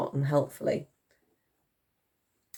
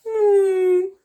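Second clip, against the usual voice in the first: high, loud woo; mammoth hoot; 8 dB louder than usual. A narrator's reading. Mammoth joining the cheer, hooting. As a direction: hoot in triumph. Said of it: "added Woolly".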